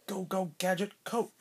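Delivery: Gadget catchphrase. Acted. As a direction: call it out like a cartoon hero.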